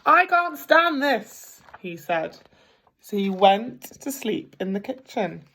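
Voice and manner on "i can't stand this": much higher, louder, slow fed-up wail; exasperated. Acted. Mr Bear woken again, high slow wail. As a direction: whine it out slowly in despair.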